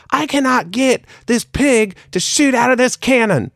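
high-pitched, whiny mock yelling; imitated frustrated daughter. Daughter yelling to dad about her game, imitated frustration. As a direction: complain like a child fed up with a game. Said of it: "she starts yelling".

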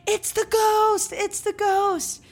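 high, slow mock shriek of fright; mock screaming. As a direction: shriek it like you just saw a ghost. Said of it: "the two began screaming".

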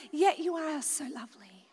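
soft, tender reassurance; tender voice of God. Acted. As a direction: speak it softly, like a loving voice over her. God speaks tenderly over her.

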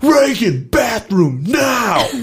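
loud, high, slow parental bellow; angry father shouting down from the observation area; booming shout. Retold or imitated. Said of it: "I hear this booming voice".

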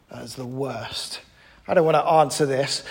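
low, hushed aside to yourself; acted reluctance, sinking and quiet; inward dread. Dreading being put on the spot.

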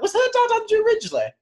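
very high, loud and baffled; high confused squeal. Retold or imitated. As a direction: squeal it out in disbelief. Retold confused reaction, very high.

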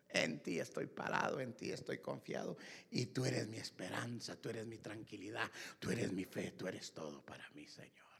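low, soft, breathy prayer to the Lord; hushed devotional whisper. Acted. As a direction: pray it quietly, close in.

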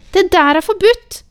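high, loud cry of protest; child's shriek. A narrator's reading. Sofia warns grandmother off the forbidden place, shrieking high. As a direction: shout it out high as an alarmed child. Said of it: "skrek Sofia".

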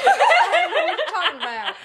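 put-on high, loud country accent through laughter. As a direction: mimic a kid's country twang, cracking up. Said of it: "talk to each other in this country accent".

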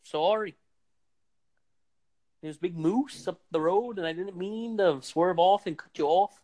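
hammed-up Canadian apology with swooping tune; full Canadian accent. Apologizing for swerving round a moose, hammed-up Canadian accent. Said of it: "some of them are, like, full".

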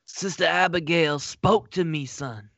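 slow, drawn-out wrestler impression; Bray Wyatt. Co-host attempts a wrestler's cult-leader line, slow impression. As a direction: preach it slowly, dropping away on the last word. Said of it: "That wasn't a good Bray Wyatt impression".

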